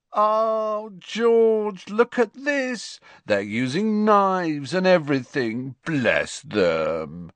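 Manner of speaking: very high, drawn-out patronising gush, falling at the end; mock cooing. A narrator's reading. Fred mocking the boys' peeling, gushing coo. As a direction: coo over them as if they were adorable toddlers. Twin coos mockingly at the peelers.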